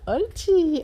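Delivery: high bright good-dog praise; praising a dog. Real, not acted. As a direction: cheer the dog on.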